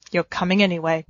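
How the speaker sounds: ordinary delivery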